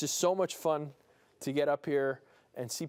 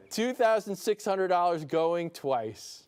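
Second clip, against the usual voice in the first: drawn-out, slow "going twice"; slow auction call; slower than usual. Real, not acted. Auctioneer slowing down while a caller verifies. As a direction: stretch each number out. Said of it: "I'll say it slowly so that we can verify".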